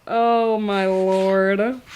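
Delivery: very slow, drawn-out exasperation; exasperated. Real, not acted. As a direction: stretch every word in disbelief. Exasperated at the book.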